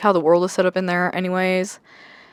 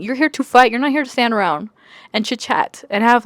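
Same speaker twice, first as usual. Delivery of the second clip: ordinary talk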